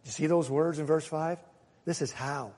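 ordinary talk, nothing marked

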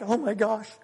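high, whiny mock panic; panicked worrier. God biting his nails over world news, mock panic. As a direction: fret like a nervous wreck, voice climbing high. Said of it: "God's in heaven just biting his nails".

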